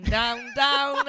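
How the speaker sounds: building song lyric. Retold or imitated. DJ realising the lyrics fit a relegated team, building to a shout.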